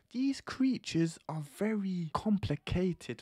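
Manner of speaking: high, slow ominous warning; mock-ominous slow delivery; dramatic. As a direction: draw it out like a grave warning. Slow dramatic warning about girls.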